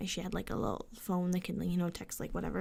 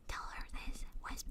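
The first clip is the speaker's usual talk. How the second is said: quiet coaching; 11 dB quieter than usual. Retold or imitated. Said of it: "trying to talk it, like, really quietly".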